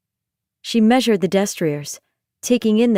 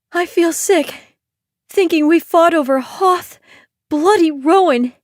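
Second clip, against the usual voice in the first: queasy Ione; 9 semitones higher, 4 dB louder than usual. Acted. Ione's voice.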